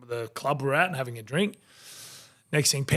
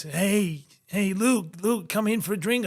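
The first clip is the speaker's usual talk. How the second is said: raised-pitch, hailing bouncer impression; friendly mock call-out, pitched up; 7 semitones higher than usual. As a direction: imitate a doorman beckoning a regular inside. Voices bouncer calling him in.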